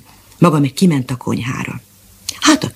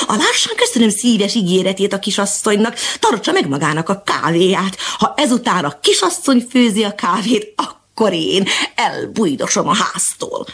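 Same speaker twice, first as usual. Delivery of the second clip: higher, huffy cat voice; cross cat; 6 semitones higher than usual. Acted. The offended cat refuses the coffee, huffy. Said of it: "olyan mérges lett".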